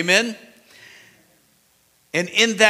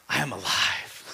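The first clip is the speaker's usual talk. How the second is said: hushed I am alive; 6 semitones lower, 7 dB quieter than usual. Acted. Jesus speaking to fallen John.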